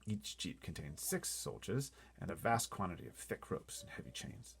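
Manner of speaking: ordinary delivery, nothing marked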